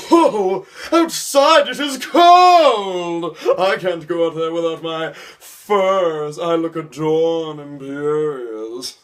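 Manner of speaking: high, slow, grand mock-dramatic voice; pompous theater kid. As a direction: ham it up like a self-important thespian. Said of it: "all the people that think they're all that".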